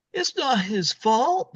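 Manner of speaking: high, slow mock mother; pleading mother. Mother defending Dewey to the father, high and slow.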